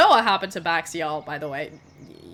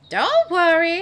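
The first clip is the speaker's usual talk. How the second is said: high, loud sly hag; 9 semitones higher, 7 dB louder, slower than usual. Acted. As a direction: purr it like a scheming hag. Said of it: "She goes like".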